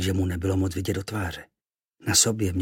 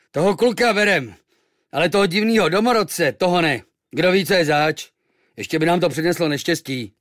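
higher, louder sailors' verdict; decide like wary old sailors; gruff sailors; 8 semitones higher, 7 dB louder than usual. Acted. The sailors refuse the native, higher and louder.

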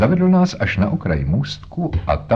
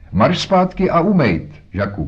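scolding shout; 10 semitones higher, 3 dB louder than usual. A narrator's reading. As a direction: shout an order like a cross mother. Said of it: "křičela". (rozzlobený mateřský křik)

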